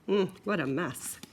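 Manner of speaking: ordinary delivery, nothing marked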